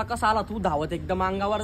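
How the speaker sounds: usual speaking voice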